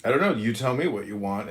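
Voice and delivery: ordinary talk, nothing marked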